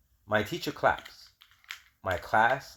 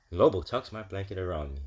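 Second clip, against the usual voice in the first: ordinary delivery, nothing marked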